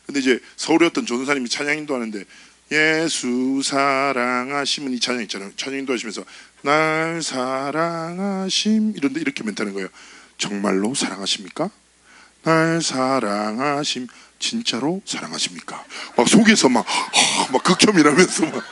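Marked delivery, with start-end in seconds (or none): 2.7-4.9 very slow, syrupy drawl of the line; greasy Seoul leader. Retold or imitated. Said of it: "너무 느끼하게 얘기한다는". 6.6-15.8 very slow, syrupy drawl of the line; greasy Seoul leader. Retold or imitated. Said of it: "너무 느끼하게 얘기한다는".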